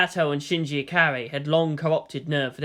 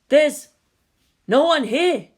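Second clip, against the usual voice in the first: higher, louder alarmed officer; alarmed report; 10 semitones higher, 6 dB louder, slower than usual. Acted. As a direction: call it out, startled. Officer reports empty desert.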